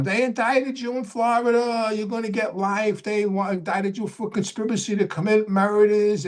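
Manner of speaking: imitating his frantic mother, pitched high; mother screaming. Mother on the phone telling her son he's indicted, high frantic mock voice. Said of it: "my mother screaming on".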